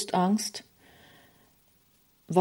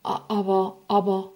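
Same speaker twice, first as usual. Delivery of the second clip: astonished stammer. Acted. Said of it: "betrachtet erstaunt".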